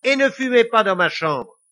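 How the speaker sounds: high, loud telling-off; indignant order. A narrator's reading. Lily forbidding smoke in her room, indignant. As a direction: tell him off firmly.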